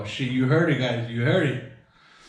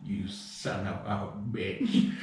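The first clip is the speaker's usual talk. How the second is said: put-on grumpy old lady; 6 semitones lower, 8 dB quieter, slower than usual. Old lady cursing prank caller.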